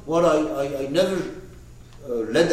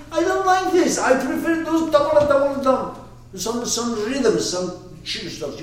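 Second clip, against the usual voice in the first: higher mock voice of a student waving it off; imitating a dismissive listener; 11 semitones higher, 3 dB louder than usual. Student mocking the teacher's poetry talk, higher mock voice. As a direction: play the bored skeptic who prefers beats. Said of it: "You could even sit there and say".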